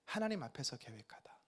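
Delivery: ordinary talk, nothing marked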